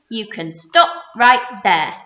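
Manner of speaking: loud, slow stern stop; sharp warning. A narrator's reading. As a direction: cut him off firmly. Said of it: "the Earthworm said sharply".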